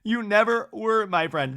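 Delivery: hammed-up hurt accusation; mock tearful. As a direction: act out tearing up, emphatic and drawn out. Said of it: "she's tearing up in the talking head".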